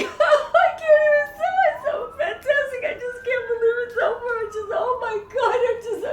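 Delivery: squealed mock sobbing, very high; hysterical crying. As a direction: blubber it in hammed-up hysterics. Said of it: "I'm just hysterical crying".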